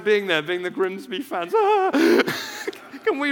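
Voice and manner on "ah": mock anguished cry. Imagined Grimsby fans' reaction.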